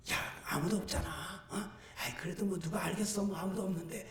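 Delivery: low, hushed coaxing; tempting inner voice. Acted. Temptation telling him nobody is watching, hushed coaxing. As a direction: coax secretly, very soft and low. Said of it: "이 속에서 또 그런 마음이 드네요".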